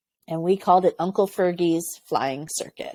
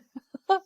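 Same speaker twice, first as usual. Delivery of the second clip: bright high burst of laughter; high giggle; 12 semitones higher than usual. Real, not acted. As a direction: giggle at your own joke. After hallelujah joke.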